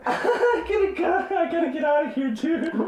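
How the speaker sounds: helpless high-pitched laughing; crying with laughter. Real, not acted. Cohost needing to get out, crying with laughter. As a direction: squeal it out through uncontrollable laughter. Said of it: "I'm literally crying".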